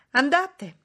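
high, loud command; shouted order. A narrator's reading. Young lady orders the servant off, shouting. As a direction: cry out a sharp dismissal. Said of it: "gridò la signorina Ingram".

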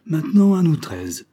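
high, loud announcement; forceful skipper opening an interrogation; captain's challenge. Acted. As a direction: take charge of the room. Captain turns on the castaways.

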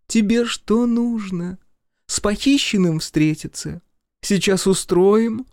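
high, sweetly menacing Masha; mock-tender. A narrator's reading. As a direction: coo it like a threat.

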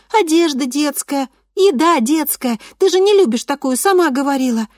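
put-on high, insistent child's voice; a ten-year-old pushing mum away; little girl voice. A narrator's reading. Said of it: "повторяла".

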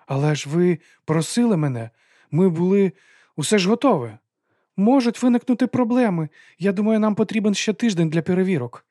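high, pressing argument for delay; urgent protest. A narrator's reading. Lady pleads for delaying the launch.

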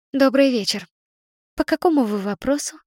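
brisk and cheery, like an office receptionist; cheerful woman's voice. Acted. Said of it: "ответил бодрый женский голос".

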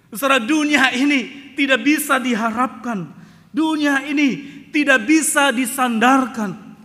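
loud, high preaching; preaching at full force. Acted. Preacher warning the congregation, loud and high. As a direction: proclaim it, slowing down.

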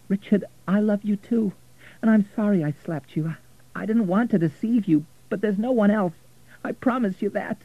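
high, emotional pleading; tearful, urgent reassurance; voice cracking. A narrator's reading. Florentina apologising to Richard after the slap, voice cracking. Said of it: "Her voice cracked".

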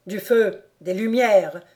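cried out. Acted. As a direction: call for fire and light in a panic. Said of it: "s'écria le comte".

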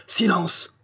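high-pitched restrained call; crowd's cry. A narrator's reading. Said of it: "cria tout le monde". (cri de foule, aigu et contenu)

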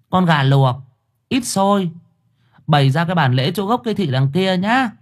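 high, brisk medium ordering offerings; a medium directing the family; confident medium. A narrator's reading. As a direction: command them like a spirit medium who knows best. Spirit medium gives orders.

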